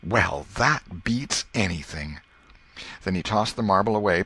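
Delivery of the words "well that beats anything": perplexed. Acted. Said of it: "with perplexed air and said".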